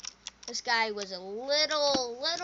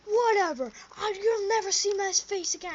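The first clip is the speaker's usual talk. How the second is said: high, louder huffy storm-off; huffy exit; 9 semitones higher, 7 dB louder than usual. Retold or imitated. Drunk guy leaving the party, high huffy. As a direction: storm off in a huff. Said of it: "he was like".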